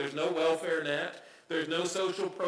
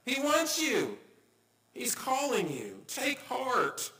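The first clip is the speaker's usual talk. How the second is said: excited urging; 8 semitones higher, slower than usual. Retold or imitated. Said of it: "they say".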